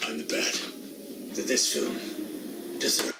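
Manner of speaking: low, raspy whisper of a hero; Batman voice. Acted. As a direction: growl it like the Dark Knight. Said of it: "gravelly voices ... Batman impression".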